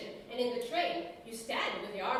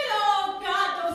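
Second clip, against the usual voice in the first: anguished outcry; 7 semitones higher, 10 dB louder than usual. Acted. Groan at beastly tenors.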